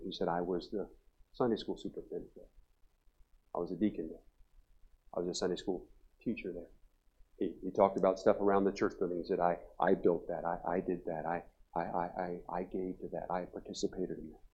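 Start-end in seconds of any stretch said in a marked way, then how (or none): 0.3-6.7 low, very soft, with pauses; subdued recollection. Retold or imitated. Old man recalls his lost roles. 9.4-14.4 low, hushed, halting recital; subdued hurt. Retold or imitated. Old man recalls his hurt.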